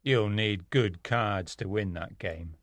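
very low, slow, cold voice; a spy's cold, unhurried deep voice. A narrator's reading. Spy warning his opponent about the card game, coldly. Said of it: "the spy said coldly".

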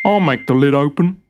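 a hint of a proud strong tool; proud hammer. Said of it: "said the hammer".

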